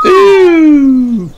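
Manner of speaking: loud high ooh; excited ooh. Acted. Children cry out in the cave, delighted ooh. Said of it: "cried Mandy and her friends".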